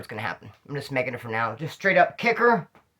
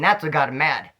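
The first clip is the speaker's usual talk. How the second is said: ordinary talk, nothing marked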